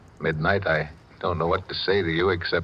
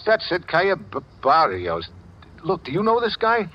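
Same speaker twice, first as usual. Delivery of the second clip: higher pitched, eager; eager excitement; 9 semitones higher than usual. Acted. Man grabs her clue to find the photographer, eager excitement. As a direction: seize on the clue. He recognizes the street name.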